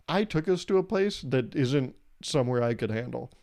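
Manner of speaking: ordinary talk, nothing marked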